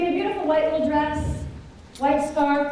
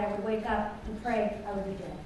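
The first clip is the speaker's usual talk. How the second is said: ordinary talk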